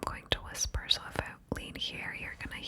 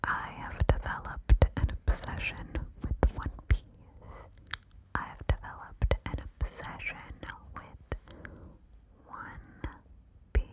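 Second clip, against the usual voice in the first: whisper in right ear. Shown as a demonstration. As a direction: close secret whisper on the right. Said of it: "This next secret I'm going to whisper in your right ear".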